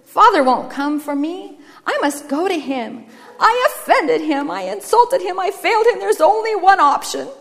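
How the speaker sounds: confessing brother, pitch climbing; distraught brother. Acted. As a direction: build in intensity as you blame yourself. Brother's speech in story.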